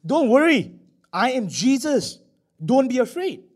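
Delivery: high, ringing call of reassurance; loud, high, emphatic reassurance; Jesus reassuring. Acted. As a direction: call out to calm them, pitch lifted. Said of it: "Jesus said to them".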